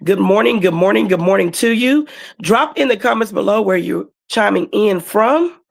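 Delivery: ordinary delivery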